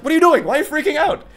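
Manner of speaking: high-pitched mock alarmed mother; exasperated mom. Mom to her screaming daughter in the car, hammed-up exasperation. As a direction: imitate a mom thrown by a tantrum. Mom reacts to child's tantrum.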